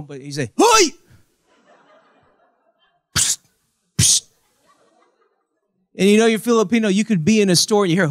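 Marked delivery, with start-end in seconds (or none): 0.6-0.9 sharp, very high loud holler; high Filipino-style hail; attention-getting call. Shown as a demonstration. 3.2-4.3 two short hissed pssts; sharp voiceless psst; attention-getting psst. Shown as a demonstration. Demonstrates attention-getting hiss.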